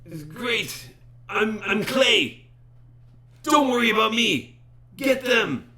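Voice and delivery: high, loud, eager cries of relief; excited shout. Acted. A chained sailor urging the party to attack, shouted high and loud. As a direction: call out thrilled, urging them to fight. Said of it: "He goes".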